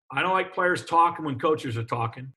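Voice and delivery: ordinary delivery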